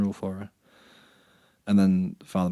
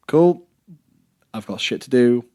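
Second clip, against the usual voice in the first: louder, higher acted "busy right now" reply; raised voice, retelling an impatient dismissal; retold brush-off; 4 semitones higher, 10 dB louder than usual. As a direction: brush them off loudly, mock-busy. Brushing off people who interrupt him.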